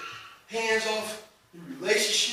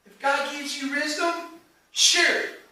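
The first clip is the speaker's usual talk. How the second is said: much higher, louder preaching build; preaching at full force; 9 semitones higher, 15 dB louder than usual. Real, not acted. Preacher building to a climax before the congregation, fervent. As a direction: drive the point home to the congregation.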